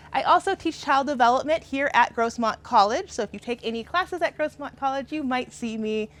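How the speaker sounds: ordinary talk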